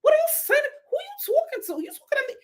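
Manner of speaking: squeaky high mock Brooklyn-ish accent. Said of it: "where the hell are you from? The accent is all over the place".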